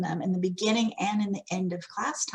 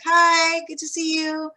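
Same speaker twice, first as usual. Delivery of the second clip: distracted hello; 7 semitones higher, 5 dB louder, slower than usual. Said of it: "being like".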